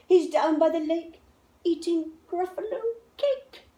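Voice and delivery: high, sly voice; a snake sending the child away. A narrator's reading. Snake tricking the Gruffalo's child, high and sly. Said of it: "said the snake".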